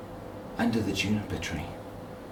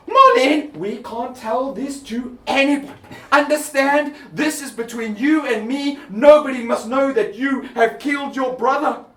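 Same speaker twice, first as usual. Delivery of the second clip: high, loud urgent hush-up; mother swearing daughter to secrecy; 7 dB louder than usual. Acted. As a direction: press the secret on her daughter.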